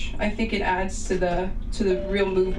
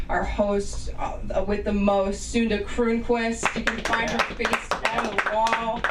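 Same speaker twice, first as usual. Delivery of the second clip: ordinary talk, nothing marked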